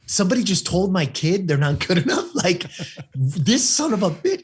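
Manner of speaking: indignant parent. Retold or imitated. Parent hearing their kid was insulted, mock indignant. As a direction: act out a parent taken aback and incensed. Voices an outraged listening parent.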